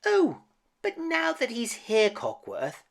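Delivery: high kindly teapot voice; motherly teapot voice. A narrator's reading. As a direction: plead gently as a motherly teapot. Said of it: "said Missus Potts".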